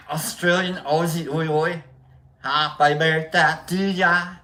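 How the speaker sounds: mock Australian accent, chanting then singing; bad Australian accent. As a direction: ham up a terrible Aussie accent. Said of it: "all of this in Australia".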